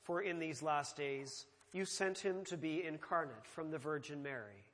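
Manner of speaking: ordinary delivery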